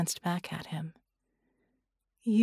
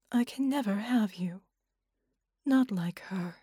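whispered, softer admission; hushed resignation; 3 dB quieter than usual. A narrator's reading. Woman admitting to Red she can never have him, whispered. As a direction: breathe the words out in defeat.

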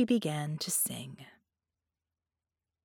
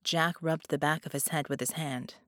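ordinary delivery

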